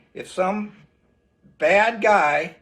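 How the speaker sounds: normal talking voice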